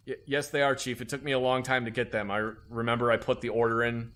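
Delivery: normal talking voice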